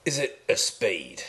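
slow, friendly West Country yokel; a country contestant greeting the host; West Country accent. Pretend. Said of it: "I'll talk like a West Country man then".

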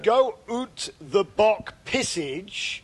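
put-on slow, mangled French-accented English; overdone French accent. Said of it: "if you overdo it".

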